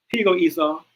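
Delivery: usual speaking voice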